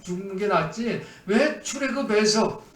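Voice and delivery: ordinary talk, nothing marked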